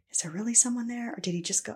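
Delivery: quick, hushed thought; retold inner wondering. Blindfolded, wondering who came in, hushed inner voice. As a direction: wonder it under your breath.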